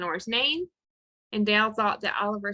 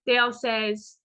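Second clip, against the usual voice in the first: ordinary talk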